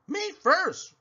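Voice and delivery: high eager cry; cried out. Acted. Pinkerton answers the voice with a high cry. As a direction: claim it first. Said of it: "cried Pinkerton".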